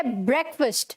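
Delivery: ब्रेकफास्ट as "BREK-fust"; short e and reduced second vowel in ब्रेकफास्ट; correct pronunciation. Shown as a demonstration. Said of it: "फास्ट नहीं है".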